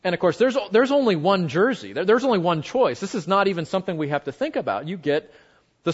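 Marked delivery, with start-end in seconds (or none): none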